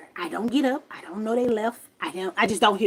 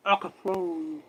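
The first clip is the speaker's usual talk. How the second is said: low, soft imitation of a ghost; 4 semitones lower, 6 dB quieter than usual. Quoting the ghost claiming power over her, low soft voice. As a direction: murmur it low and indistinct. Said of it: "That man said".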